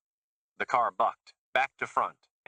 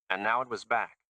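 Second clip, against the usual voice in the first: ordinary delivery, nothing marked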